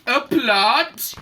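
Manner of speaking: loud, disbelieving question; horrified yell. A narrator's reading. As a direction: yell it as a scandalised mother. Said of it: "yelled Mum in horror".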